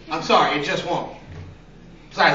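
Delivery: normal talking voice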